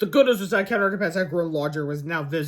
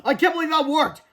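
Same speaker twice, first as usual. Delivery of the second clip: high-pitched disbelieving excitement; acted excited character voice; amazed cry; 10 semitones higher, 4 dB louder than usual. A narrator's reading. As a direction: exclaim at an unlikely success. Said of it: "cried Cheeseball".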